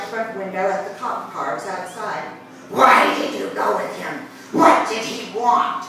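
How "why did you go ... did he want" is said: hissed. Retold or imitated. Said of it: "she hissed at me".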